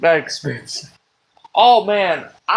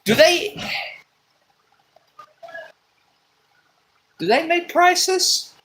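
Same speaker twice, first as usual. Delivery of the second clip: incredulous question; 13 semitones higher than usual. Pretend. Rhetorical outrage at prices.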